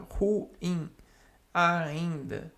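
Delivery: ordinary delivery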